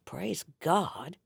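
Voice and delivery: usual speaking voice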